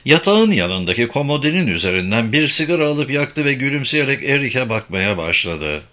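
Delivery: ordinary delivery, nothing marked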